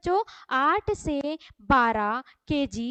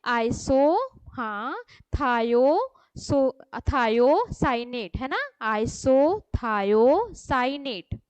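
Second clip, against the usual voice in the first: Iso, थायो, thiocyanate built up slowly piece by piece; slow chunked drilling; syllable by syllable; 3 semitones lower, much slower than usual. Shown as a demonstration. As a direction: break the word into chunks for the class. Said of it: "जोर से बोलोगे आप".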